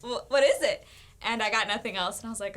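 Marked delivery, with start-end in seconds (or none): 0.0-0.9 mock-exasperated questions, tumbling out and restarting; retold impatient questioning. Woman recounting how she pressed God for answers, mock impatient. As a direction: press for an answer, playfully insistent.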